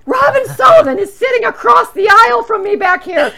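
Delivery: high, loud laughing shout; excited shout. Retold or imitated. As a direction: yell it out to the row ahead, thrilled. Fan shouts to friend ahead.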